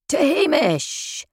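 a high, slow roared group toast; rowdy jeering pub banter. A narrator's reading. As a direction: roar it back like rugby lads. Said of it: "The six big rugby lads roared back at me".

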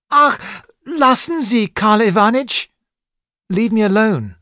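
put-on high, loud cry of a boy near tears; tearful shout. A narrator's reading. Boy shouting at his tutor through tears, high and loud. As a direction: shout him away, on the edge of tears. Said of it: "I shouted, with tears in my eyes".

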